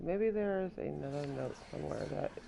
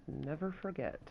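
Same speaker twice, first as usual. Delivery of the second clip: ordinary talk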